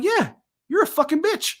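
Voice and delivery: put-on high, laughing little kid voice; a nine-year-old finally gets to cuss his dad out; cheeky kid voice. Youngest kid insults dad.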